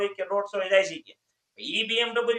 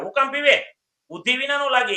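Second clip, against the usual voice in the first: loud, higher angry scold; angry doctor scolding; 7 semitones higher, 7 dB louder than usual. Acted. Temperamental doctor scolds milk-drinking patient, loud angry rebuke.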